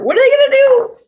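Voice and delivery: high squeaky mock worry; squeaky panic. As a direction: mimic the scribbles' fretting. Mock panic aside for kids.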